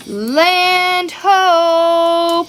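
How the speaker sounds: high, loud call of land ho; cried out. Acted. Pete to his shipmates, a high loud call of land ho. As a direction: call out like a lookout spotting land. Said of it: "Pete cried".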